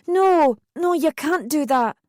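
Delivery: high, loud alarmed no; high-pitched, urgent refusal; alarmed protest. A narrator's reading. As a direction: cry out to stop him. Stopping him going to tell.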